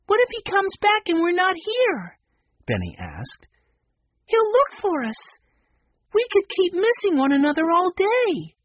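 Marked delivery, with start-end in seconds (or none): 0.1-2.2 put-on very high, childlike questioning voice; young child. A narrator's reading. Young Benny's question, voiced in story. 4.3-8.5 put-on very high, childlike voice; child character. A narrator's reading. Child characters argue in story.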